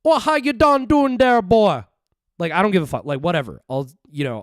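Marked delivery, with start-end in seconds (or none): 0.0-1.8 much higher, louder mock Jamaican old lady; a hammed-up old Jamaican woman, pitched way up; Jamaican lady impression. Streamer shows he'll do any voice, mock Jamaican old lady. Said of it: "Old Jamaican lady".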